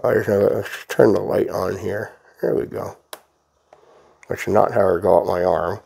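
talking around a brush in teeth; brush in mouth. Real, not acted. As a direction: speak with a brush clamped in mouth. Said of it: "I'm holding the brush in my mouth".

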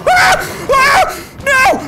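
panicked high screaming; terrified screams. Real, not acted. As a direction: scream in sheer terror. Attacked by a huge cougar.

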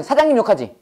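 normal talking voice

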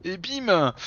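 punchy, triumphant exclamation; excited punchline. Real, not acted. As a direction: land it like a knockout. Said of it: "Et d'où le bim!".

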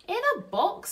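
very high, loud astonished question; Betty echoes Bill, astonished and high; surprised Betty. Acted. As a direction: gasp it out in disbelief.

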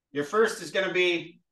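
usual speaking voice

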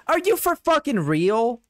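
high-pitched real disbelief; can't believe what you just heard; incredulous. Real, not acted. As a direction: cut in, appalled and disbelieving. Incredulous reaction to the review.

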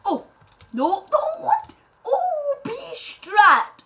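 very high, loud squeal of disbelief; high yell, stretched out slowly; amazed outburst. Real, not acted. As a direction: shriek at an unbelievable play. Amazed by a move in game.

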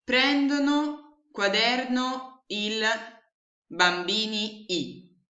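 "prendono quaderno il bambini i" said slowly, word by word; slow deliberate mix-up; slow scrambled words. Shown as a demonstration. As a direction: read the jumbled words out slow and puzzled. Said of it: "se io usassi le stesse parole".

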